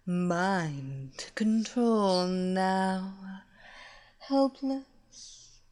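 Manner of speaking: slow, sultry villainess luring her victim; seductive hypnotist. Acted. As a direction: purr it like a temptress casting a spell.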